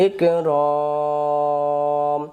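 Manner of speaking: ইকরম with the vowel held very long; long drawn vowel. Shown as a demonstration. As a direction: draw out ইকরম slowly as a recitation demo. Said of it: "আলিফটা বাদ যাবে".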